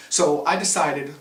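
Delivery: ordinary delivery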